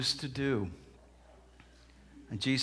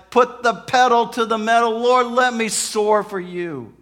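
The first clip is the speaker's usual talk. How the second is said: loud, raised cry to the Lord; acted rousing prayer; impassioned prayer; 8 semitones higher, 11 dB louder than usual. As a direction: build to a fervent preacher's climax. Prayer swells to full force.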